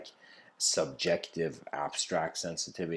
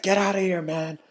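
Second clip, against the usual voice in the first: loud, much higher shout to get rid of him; angry shout; 13 semitones higher, 9 dB louder than usual. Acted. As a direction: yell him off the doorstep. Lady shoos away thirsty mailman.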